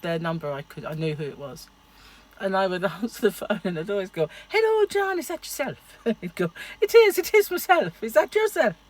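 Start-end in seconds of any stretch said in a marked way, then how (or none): 4.5-5.8 high lilting Irish greeting; mock Irish phone greeting; answering the phone to John in a high Irish lilt; Irish accent. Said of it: "most gorgeous accent". 6.8-8.8 high, loud Irish reply; John answering in a lilting Irish accent; mock Irish voice of John. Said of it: "from Southern Ireland".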